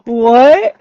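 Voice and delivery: can't believe what he read; stunned what. Real, not acted. Disbelief after laugh.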